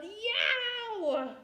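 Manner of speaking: high, loud yell, stretched out on hurts so much; a child wailing loudly at a hurting tooth; yelling in pain. Acted. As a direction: wail for both parents. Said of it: "and yelled".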